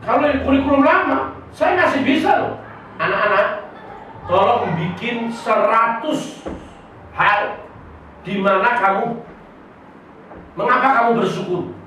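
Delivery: high, loud commanding teacher; strict teacher demand. Retold or imitated. A teacher loudly demanding a hundred things from pupils, commanding. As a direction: bark the assignment at the pupils.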